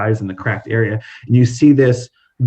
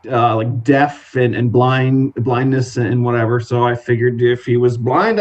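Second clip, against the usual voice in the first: ordinary talk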